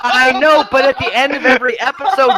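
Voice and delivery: loud, high-pitched comeback; exasperated insistence. Real, not acted. Defending the Zaboomafoo ending to co-host, exasperated insistence. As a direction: insist over the other person. Heated argument about lemur.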